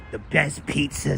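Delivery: hint of a Bay Ridge wiseguy plugging local spots; William DeMeo impression. Streamer imitating an actor for his chat, lightly put-on Brooklyn voice. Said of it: "that's a William DeMeo voice".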